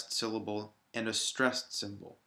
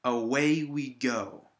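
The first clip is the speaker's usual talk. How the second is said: slow "Away we go", beats on "way" and "go"; stress on WAY and GO; 4 dB louder, slower than usual. Shown as a demonstration. Teacher demonstrating stressed syllables, slow beats on way and go. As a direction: slowly lean on "way" and "go", lightly on the rest. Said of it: "is on way and go".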